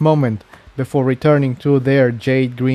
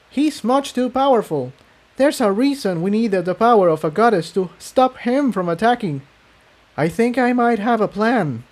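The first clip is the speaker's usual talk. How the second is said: higher mare voice; female pony voice; 10 semitones higher than usual. Acted. Incendia and Cerulean discussing, in higher mare voices. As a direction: lift into a lighter mare voice.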